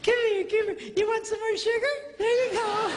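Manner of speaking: high, sing-song coaxing voice; coaxing sweet-talk. Acted. Pastor coaxing with a sweet treat, high coaxing voice. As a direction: coax them close with sugar.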